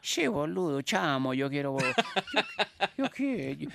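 high, joking Argentine accent. Shown as a demonstration. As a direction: ham up an Argentine accent, breaking up a little. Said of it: "¿Cómo era el acento argentino?".

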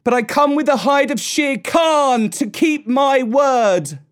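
high, triumphant proclamation; shout. A narrator's reading. Mowgli tells Mother Wolf he kept his word, shouting. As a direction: shout it out proudly. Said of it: "shouted Mowgli".

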